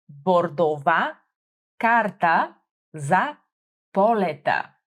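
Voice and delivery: Бордова карта за полета said slowly, word by word; slow the phrase down for learners; slow demo. Shown as a demonstration. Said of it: "teacher models phrase slowly".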